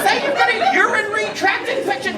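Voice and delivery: high, loud comic scolding; exasperated outburst. Acted. Son telling dad off for his greeting, exasperated outburst.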